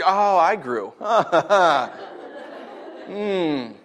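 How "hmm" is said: ordinary talk, nothing marked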